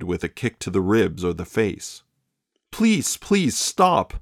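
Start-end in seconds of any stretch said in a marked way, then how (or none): 2.7-4.1 put-on high, very slow young woman's plea; young girlfriend pleading for mercy. A narrator's reading. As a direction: beg him to stop, drawn out. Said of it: "pleaded young Lorraine".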